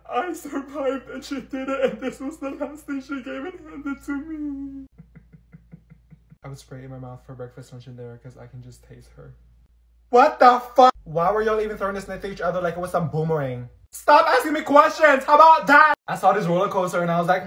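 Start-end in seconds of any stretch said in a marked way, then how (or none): 0.1-4.9 mock tearful. Tearful memory of dead mom. 13.9-15.9 high, loud yell; furious outburst at the teasing questions; angry shout. Acted. Annoyed girl snaps back.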